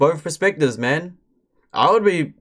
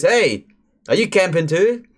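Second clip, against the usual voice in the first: higher-pitched, harmless-sounding camper greeting; lightly acted friendly hail, pitched up; not creepy voice; 4 semitones higher than usual. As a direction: imitate a stranger calling out a non-threatening question. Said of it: "In a not creepy voice".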